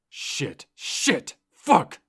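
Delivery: building to a high, loud furious yell; spat curses. Acted. Driver beating the wheel after the crash, spat curses. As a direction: spit the curses out. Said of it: "he spat".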